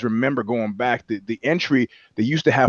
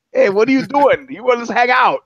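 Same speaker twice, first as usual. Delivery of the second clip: higher, playful come-on; jokingly asking a celebrity to hang out, higher and playful; mock flirty invite; 9 semitones higher, 4 dB louder than usual. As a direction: ham up a cheeky invitation to hang out. Retold invite to Patricia Arquette.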